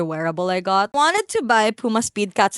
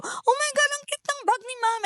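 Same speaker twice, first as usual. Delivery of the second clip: much higher, gushing sales lady voice; 4 dB quieter than usual. Retold or imitated. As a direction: squeal over the bag like a shop clerk. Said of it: "hear the sales ladies just go".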